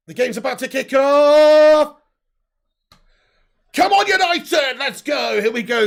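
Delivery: loud, high, slow shout of support; excited yell. Real, not acted. Fan cheering United at kickoff, full shout. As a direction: bellow for your team as the game starts.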